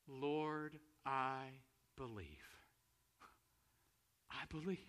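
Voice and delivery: slow, low and soft confession; quiet prayer with long pauses; hushed prayer. Retold or imitated. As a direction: let each word settle, reverently. Repeats the confession as simple prayer.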